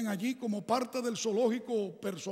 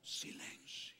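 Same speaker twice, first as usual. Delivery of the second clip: breathy whispered word, almost no sound; 20 dB quieter, slower than usual. Shown as a demonstration. A preacher dramatizes the silence, whispering. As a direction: whisper it into the hush. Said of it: "yo quiero dramatizar el asunto".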